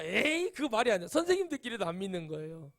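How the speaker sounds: usual speaking voice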